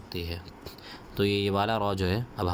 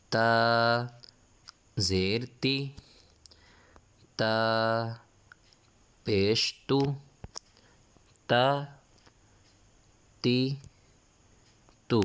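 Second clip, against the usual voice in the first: Qaida recitation drill; 3 semitones higher, much slower than usual. Shown as a demonstration. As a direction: call out the letters so the class can repeat. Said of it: "سبق کو میرے ساتھ ساتھ دہرائیں".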